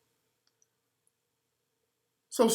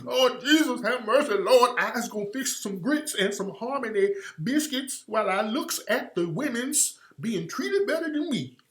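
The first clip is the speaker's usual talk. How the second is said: higher-pitched, hammed-up Southern plantation voice; mock plantation dialect; 3 semitones higher, 4 dB quieter than usual. Mocking the talk she never had, higher plantation dialect. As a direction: wail it as an exaggerated plantation caricature. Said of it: "would have talked like that".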